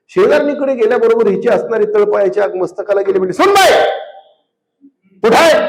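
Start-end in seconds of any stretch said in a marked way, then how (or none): 3.4-5.6 high, loud call across to the neighbour's house; mother-in-law shouting. Retold or imitated. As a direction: yell for the daughter-in-law from home. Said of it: "पुन्हा अजून ओरडली". (सासूची मोठ्याने उंच हाक)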